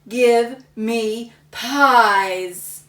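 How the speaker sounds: very slow, loud demand; demanding boy. Acted. Samuel demanding pies at the contest, slow and loud. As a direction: drag each word out greedily.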